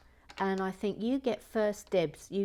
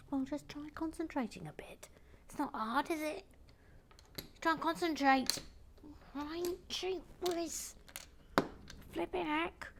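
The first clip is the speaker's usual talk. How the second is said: very high, put-on nagging voice; high-voiced scolding character; 9 semitones higher, 6 dB quieter than usual. Telling someone to concentrate, high put-on scolding voice. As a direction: nag in a squeaky little character voice. Mock scolding self in another voice.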